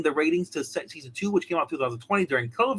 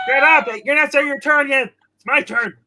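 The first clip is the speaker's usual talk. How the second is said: loud, raised-pitch telling-off; mock scolding; 6 semitones higher, 8 dB louder than usual. Host scolding Baby Yoda for jumping his turn, mock-angry shout. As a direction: shout down an interrupter in pretend outrage.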